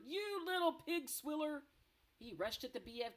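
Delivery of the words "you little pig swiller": furious insult. Acted. Blood Bottler raging.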